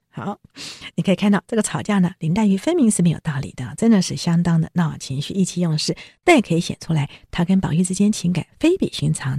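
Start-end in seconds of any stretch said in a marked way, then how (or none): none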